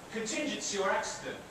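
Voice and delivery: usual speaking voice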